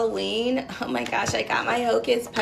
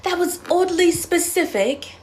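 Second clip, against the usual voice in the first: ordinary talk, nothing marked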